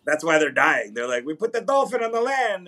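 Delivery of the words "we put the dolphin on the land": high-pitched mock Canadian accent; badly faked Canadian, pitched way up; attempted Canadian accent. Host mimics Marineland staff, attempted Canadian accent. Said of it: "I can't even with the Canadian".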